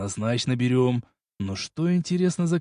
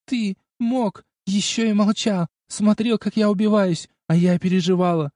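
tearful reproach; 8 semitones higher, 4 dB louder than usual. Acted. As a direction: scold him through tears. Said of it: "И снова принялась плакать".

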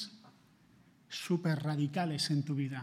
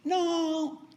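high, raised voice of God calling to account; a solemn divine question, pitched high; God asking what you did with his son; God demanding account; 10 semitones higher than usual. Acted. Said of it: "Dios te va a pedir cuenta".

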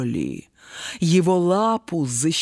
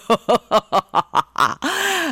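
big mocking belly laugh; booming laugh; 7 semitones higher than usual. A narrator's reading. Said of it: "расхохотался лев".

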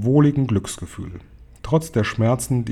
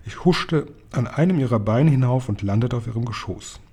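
ordinary delivery, nothing marked

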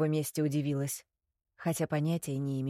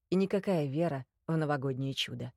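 usual speaking voice

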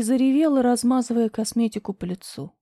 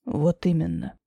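lower, calm man's voice; low male voice; 6 semitones lower than usual. Acted. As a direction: confirm it low and flat. Grisha low.

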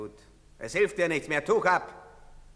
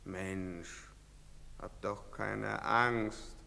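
normal talking voice

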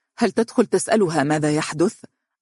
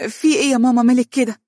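higher, louder flustered stammer; flustered daughter caught by mother; 8 semitones higher, 4 dB louder, faster than usual. Acted. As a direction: caught out and panicking. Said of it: "ارتبكت دنيا وتلعثمت".

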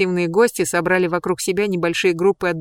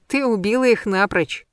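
usual speaking voice

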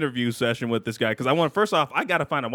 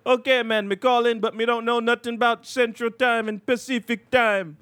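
higher mock Jamaican accent; 6 semitones higher than usual. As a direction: play a Jamaican rapper in a Jamaican accent. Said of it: "He was like".

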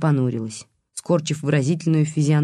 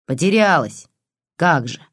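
put-on dismissive old woman's echo; scornful snort. A narrator's reading. Old woman doubting the detective's story, scornful snort. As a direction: snort the word back in disbelief. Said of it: "фыркнула старуха".

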